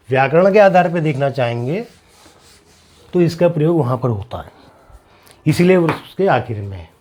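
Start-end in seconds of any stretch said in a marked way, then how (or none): none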